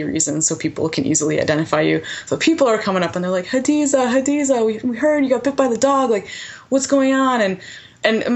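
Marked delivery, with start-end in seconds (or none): 3.5-7.4 high, urgent calls of her name from the neighbors; worried neighbors calling. Retold or imitated. Neighbors rushing up to the injured volunteer, calling high and urgent. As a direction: voice the villagers calling out to her, pitched well up.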